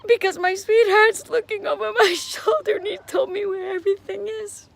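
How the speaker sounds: high, teary, choked-up voice; about to cry. Real, not acted. Widow touched that her late husband helped, near tears. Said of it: "think I'm gonna cry".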